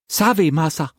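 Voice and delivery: very high, frightened pidgin servant voice; a high timid maid, pleading; frightened servant girl. A narrator's reading. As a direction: servant girl answering her master in fright. Servant Assam obeys.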